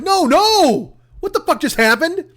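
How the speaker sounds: high, rising panicked outcry; staring at the vanished file in high-pitched panic. Retold or imitated. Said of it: "And I'm like".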